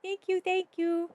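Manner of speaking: high, loud, slow gushing; gushing thanks. Real, not acted. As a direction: gush out your thanks.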